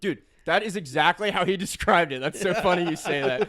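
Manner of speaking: thrilled the friend got it; excited. Real, not acted. Telling friend he described it exactly.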